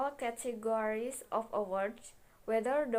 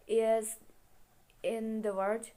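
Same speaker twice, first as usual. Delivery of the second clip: usual speaking voice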